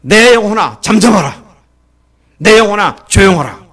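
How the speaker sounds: loud, high command to the soul; shouting to own soul. Shown as a demonstration. Preacher showing how to shout at one's own soul, loud. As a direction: cry out to your own heart. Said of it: "소리 질러야 돼요".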